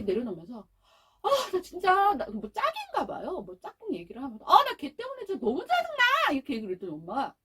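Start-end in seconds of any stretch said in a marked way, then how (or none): 1.2-3.4 put-on annoyed child. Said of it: "아이가 잔뜩 화가 났어요". 4.5-6.3 higher, whiny kid voice, mildly mocked; higher mock-annoyed child complaining about her deskmate; imitating a fed-up child. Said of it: "아이가 잔뜩 화가 났어요".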